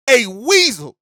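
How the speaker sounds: high, loud disbelieving repeat of weasel; incredulous echo. Real, not acted. Host echoing caller's insult, stunned and loud. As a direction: echo the insult in shocked delight. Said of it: "this man called Jordan Love a weasel".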